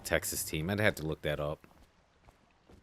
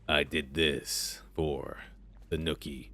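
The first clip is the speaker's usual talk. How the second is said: low, slow, gruff impression of Corvo; deep and gruff; 3 semitones lower, 5 dB quieter, slower than usual. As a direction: talk really low and gruff, dragging it out. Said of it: "I think it's deep and gruff".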